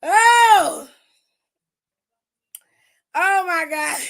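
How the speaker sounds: a sudden loud high "Oh" bursting out in disbelief; loud outcry. Real, not acted. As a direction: cry out like you can't believe what you just saw. Reacting to the clip, laughing.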